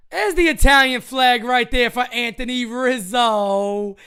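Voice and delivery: loud, thrilled home run call; excited shout. Real, not acted. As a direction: call the homer at the top of your lungs.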